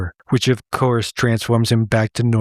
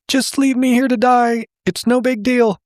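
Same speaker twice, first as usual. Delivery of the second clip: much higher-pitched little bear voice; self-sacrificing cartoon bear; 12 semitones higher than usual. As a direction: give up in a high cartoon voice. Swamp bear gives up, acted.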